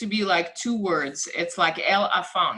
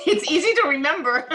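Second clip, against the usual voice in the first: laughing; 9 semitones higher, 4 dB louder than usual. Real, not acted. Laughing at compliment on name.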